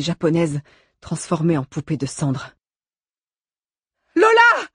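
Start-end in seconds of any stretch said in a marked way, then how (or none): 4.1-4.7 put-on high, loud scream for a child; desperate cry. A narrator's reading. Mother crying out for her daughter in the fire, desperate scream. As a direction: cry out the name with all remaining strength. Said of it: "eut la force de crier Vicky".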